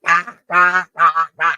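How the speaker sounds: high squawking chicken cry rising to a loud yell on Attack; mock chicken battle cry. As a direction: squawk like a furious hen. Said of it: "That chicken squatted down and go".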